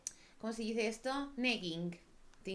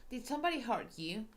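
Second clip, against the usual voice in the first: higher, urgent priest pressing gently; worried priest; 6 semitones higher than usual. Acted. Priest urging the silent girl to speak, higher. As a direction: coax her to tell, promising justice.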